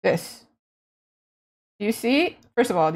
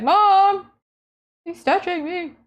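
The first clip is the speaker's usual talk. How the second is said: put-on high whiny kid tattling; whiny tattling little sibling; 9 semitones higher, 4 dB louder, slower than usual. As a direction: tattle to mom in a whine. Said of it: "Siblings, am I right?".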